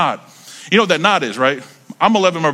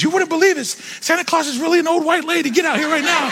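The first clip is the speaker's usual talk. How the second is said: high squealing summons; excited kid yell. Retold or imitated. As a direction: yell for mom in pure delight. Telling sisters about presents.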